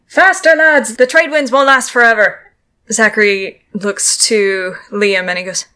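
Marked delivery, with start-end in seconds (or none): 0.1-2.5 high, loud call to the crew; midshipman yelling. Acted. Midshipman hurrying the lads along, raised yell. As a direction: yell orders across the deck. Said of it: "You hear the midshipman yelling".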